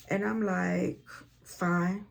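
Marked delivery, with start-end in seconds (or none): 1.4-2.1 curt attitude. Retold or imitated. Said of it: "I got an attitude".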